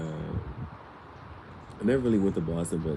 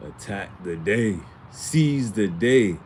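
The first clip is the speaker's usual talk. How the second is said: rallying shout; 3 semitones higher, 7 dB louder, much slower than usual. Acted. Motivational rallying call to viewers.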